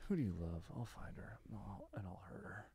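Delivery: low near-whispered villain threat; Philip Seymour Hoffman. Acted. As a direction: sound put upon, threaten in a whisper. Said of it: "so put upon to hurt some".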